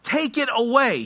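loud, high anguished plea; crying out to God. Acted. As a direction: cry out to God in full-voiced desperation. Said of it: "He actually cries out".

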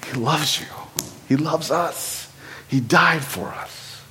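soft, slow declaration of love; hushed and tender. Real, not acted. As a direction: let each phrase land softly and slowly. Preacher softens after heated warning.

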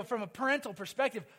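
normal talking voice

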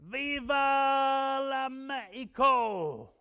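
long, high, drawn-out yell; rallying shout. Retold or imitated. Friend getting up on Mexican buses, rallying shout. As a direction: holler it to grab a whole bus's attention. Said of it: "get up on the buses and say".

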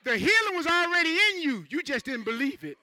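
loud, high preaching cry; preaching shout. Real, not acted. Preacher shouting to the church, loud and high. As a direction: belt it out to the congregation.

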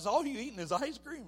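high, loud mocking voice; teasing people. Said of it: "People were like".

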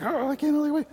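put-on high, breathless kid excitement; eager kids. Kids impatient for presents, high and eager. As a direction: gush like a child before presents. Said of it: "the kids were like".

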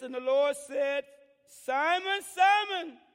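loud, high, slow scripture read; preached scripture reading. Acted. Pastor preaching Galatians to the church, loud and slow. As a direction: proclaim the verse with feeling.

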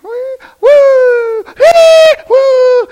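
high, loud "woo" calls like a rider; mock roller coaster whoops. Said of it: "It's not a roller coaster".

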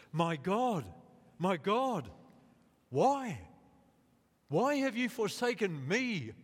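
high, loud cry of anguish. Acted. Jesus on the cross crying to God, loud anguished cry. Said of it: "Jesus cried out in a loud voice".

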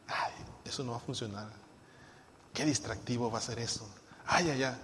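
a soft, sulky imitated complaint; mock the grumbler in the pews, muttering low; grumbling attitude. Preacher mimics a grumbling member, sulky and soft. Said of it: "Mi actitud".

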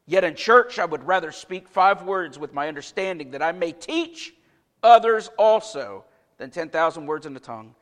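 hushed Bible reading voice; scripture reading. Acted. Reads the verse again.

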